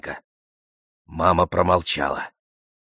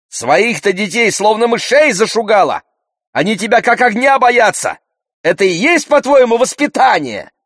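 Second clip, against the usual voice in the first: high, loud, angry accusation; shouting; 14 semitones higher, 7 dB louder than usual. A narrator's reading. Girl attacks meddling woman's parenting, shouting. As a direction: keep shouting indignantly at high pitch. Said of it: "крикнула ей Заринка".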